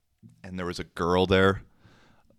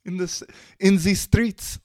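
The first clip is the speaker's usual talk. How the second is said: high French-girl imitation, louder; hammed-up French accent, pitched way up; terrible French accent; 13 semitones higher, 5 dB louder than usual. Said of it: "my French accent's terrible".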